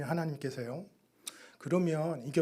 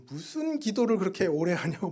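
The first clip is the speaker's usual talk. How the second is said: a retold, louder, higher angry scold; mock angry scolding; 5 semitones higher, 7 dB louder than usual. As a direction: scold him for praying too long, half-acted. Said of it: "막 화를 내시는 거예요". (오래 기도한다고 화내며 따지는 흉내)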